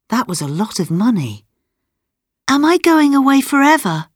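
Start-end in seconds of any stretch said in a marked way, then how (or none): 2.5-4.1 put-on high-pitched little girl's alarm; worried child's thought. A narrator's reading. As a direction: wonder anxiously in a high voice. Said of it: "thought Fenella".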